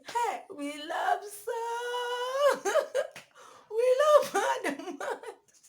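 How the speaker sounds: high, squealing laugh-talk, mock sing-song; laughing through words. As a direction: giggle the words out loud and high. Laughing friends mocking the man.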